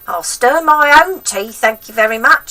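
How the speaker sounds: put-on high, loud cranky old woman; grandma voice. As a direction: nag the boy like a spiteful old grandma.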